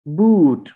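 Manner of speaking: बूट with a long drawn oo. Shown as a demonstration. Teacher models the long oo in बूट. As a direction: stretch the oo in बूट. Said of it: "ये ऊ लंबी sound है".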